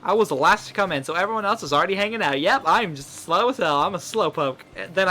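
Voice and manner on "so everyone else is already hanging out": normal talking voice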